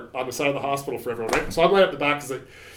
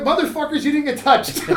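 much higher, laughing through the insult; laughing disbelief; 11 semitones higher, 5 dB louder than usual. Retold or imitated. To buddies who escaped the spray, laughing. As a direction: laugh it out at the lucky ones.

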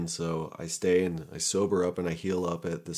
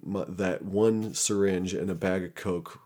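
ordinary delivery

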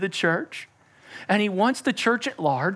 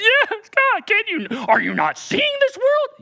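whiny believer; 4 dB louder, slower than usual. Pretend. Dialogue of believer with Jesus.

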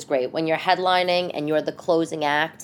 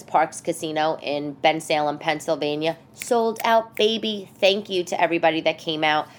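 ordinary delivery

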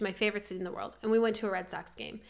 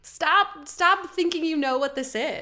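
exasperated; 6 semitones higher, 6 dB louder than usual. Real, not acted. Frustrated rant at critics.

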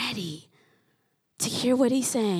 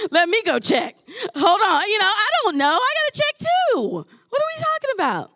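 mock flustered; 9 semitones higher, 7 dB louder than usual. Mocking the doubter's flustered reply.

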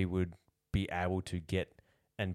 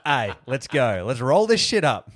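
hammed-up hype, higher and much louder; a mock rallying cry that builds louder; mock excited; 6 semitones higher, 11 dB louder than usual. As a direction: pump up the room, getting louder. Mock club deciding on mascots.

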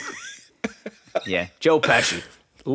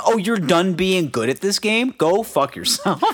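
dismissive mock voice; 7 semitones higher than usual. Voicing the NFL dismissively.

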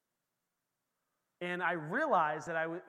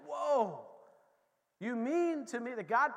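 amazed; 6 semitones higher, 4 dB louder, slower than usual. Acted. Acted astonished reaction to prayer.

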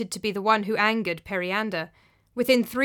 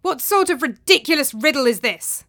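loud, high exasperated demand; irate tyrant; angry; 5 semitones higher, 7 dB louder than usual. A narrator's reading. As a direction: demand an explanation in outrage. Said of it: "said Periander angrily".

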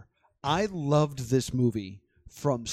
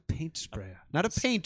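mock British accent; 3 semitones higher, 3 dB quieter than usual. Playing along with the commentator bit, mock British accent. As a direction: paint sprayer in a put-on British accent. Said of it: "You have to say with an accent".

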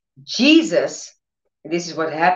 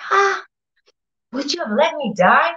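higher, stunned plea to God; stunned frustration; 7 semitones higher than usual. Retold or imitated. Cindy asking God if he would let her die, stunned. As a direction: ask it shocked and hurt. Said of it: "frustrated, stunned".

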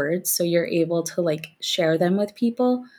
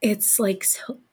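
catching a tickle in throat; choking a little mid-sentence; 4 semitones higher, slower than usual. Real, not acted. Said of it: "I'm choking".

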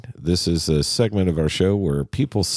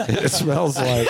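laughing; 9 semitones higher, slower than usual. Real, not acted. Laughing at the joke.

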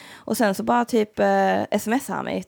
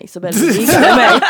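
gleeful boast; 2 semitones higher, 11 dB louder than usual. Retold or imitated. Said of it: "han kom hem skitglad och bara".

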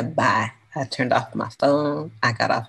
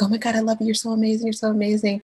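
higher-pitched retold starstruck gushing; gushing fan; 7 semitones higher, faster than usual. Fan gushing to Dave Chappelle in Oakland, starstruck. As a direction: gush like a devoted fan.

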